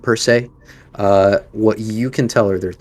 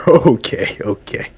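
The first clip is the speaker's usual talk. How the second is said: recovering from laughter; 2 semitones higher, 4 dB louder than usual. Acted. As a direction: let the laughter die down into okay. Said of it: "he bursts out laughing".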